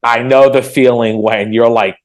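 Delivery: ordinary delivery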